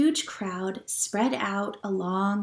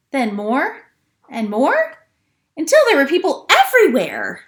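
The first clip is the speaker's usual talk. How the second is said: louder and higher on each phrase; building louder; 5 semitones higher, 8 dB louder than usual. A narrator's reading. Storyteller building up a growing crowd, louder. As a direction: swell the voice as the crowd grows.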